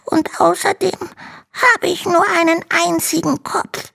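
very high small dragon voice, no tears; a tiny dragon stating his lack plainly; little dragon voice. Acted. Little dragon reminds Hanno of his one head.

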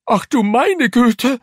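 very high, loud, drawn-out horror; shocked housekeeper. A narrator's reading. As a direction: exclaim aghast in a high, loud voice. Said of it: "schlug sich die Hand vor den Mund". (entsetzte Haushälterin, sehr hoch und laut)

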